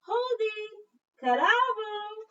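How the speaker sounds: high, slow, loud ringing greeting; cheerful boy calling hello; boy's greeting. Retold or imitated. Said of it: "He says".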